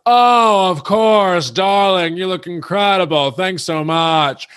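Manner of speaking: high, grand diva voice, loud at first; gracious star gushing; imitating Countess Luann. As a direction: Countess Luann cooing at a fan from the stage. Lou's gracious reply voiced.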